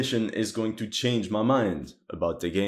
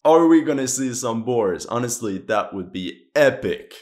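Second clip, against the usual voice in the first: normal talking voice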